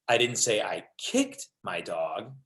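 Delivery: slow down and single out "kicked" with a pause after; stress on KICKED. Shown as a demonstration. Trainer showing tone changes meaning, stress on kicked. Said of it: "same sentence with seven different meanings".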